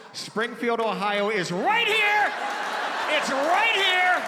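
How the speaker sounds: loud, drawn-out high yell; mock-alarmed shout. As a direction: holler it like raising the alarm. Calls out woman's job as scandal.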